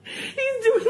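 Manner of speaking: high, laughing, half-crying words; can't stop laughing while telling it; giggly retelling, voice cracking up; laughing through it. Real, not acted.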